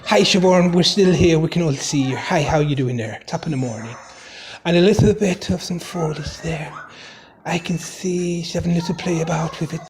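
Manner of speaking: jokey put-on Irish Jamaican accent; comic commentary in an Irish-Jamaican mash-up accent. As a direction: narrate in a mock Irish brogue with Jamaican slips. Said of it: "narrate it for her in my Irish Jamaican accent".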